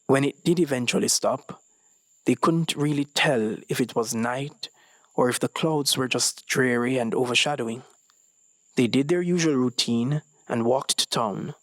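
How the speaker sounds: plain reading voice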